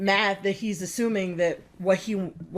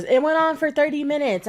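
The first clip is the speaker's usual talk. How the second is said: mocking, higher and louder; mock her claim, pitched up; mocking quote; 6 semitones higher, 4 dB louder than usual. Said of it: "I'm gonna zoom in and shake my head".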